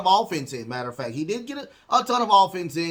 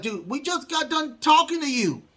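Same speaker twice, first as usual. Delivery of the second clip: mock exasperation, pitched much higher; a high, put-on voice for an annoyed Dominic; exasperated Dominic; 11 semitones higher than usual. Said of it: "Dominic's like".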